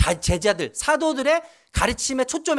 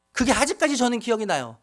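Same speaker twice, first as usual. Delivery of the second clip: normal talking voice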